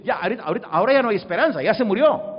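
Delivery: high, loud outburst building in force; acted hopeless protest. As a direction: cry out that it is too late. Voices Marta's loss of hope.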